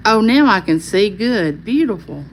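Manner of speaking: ordinary talk, nothing marked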